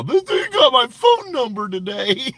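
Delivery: high, loud mock complaint; mocking whine. Streamer mimics rival complaining to girlfriend. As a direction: whine like a tattling coward. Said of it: "he probably got his fat ass girlfriend calling".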